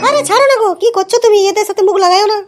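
high, pleading put-on woman's voice; a flustered wife pulling her husband back; wife's voice. Wife calming her angry husband at the clinic, high pleading woman's voice.

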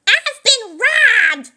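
high, slow, loud wail; crying out. Acted. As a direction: wail it out in shock. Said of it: "she cried".

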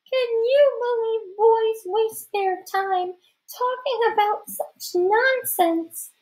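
very high falsetto with a put-on laugh; a boy covering up as a giggly girl; high, slow, affected girl voice; fake girlish laughter. A narrator's reading. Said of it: "gathered myself and gave a big laugh".

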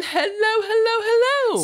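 sing-song greeting. Real, not acted. Greets the chat viewers.

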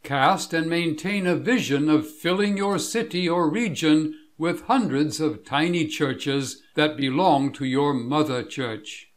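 ordinary talk, nothing marked

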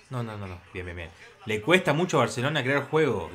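normal talking voice

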